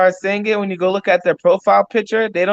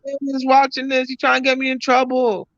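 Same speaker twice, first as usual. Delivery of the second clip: alarmed; 8 semitones higher than usual. Real, not acted. Embarrassed protest about speeding.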